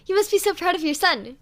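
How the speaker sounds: put-on high bright girl voice; lightly voiced chirpy girl; chirped. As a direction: chirp it like a cheerful student. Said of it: "chirped Akizuki Subaru".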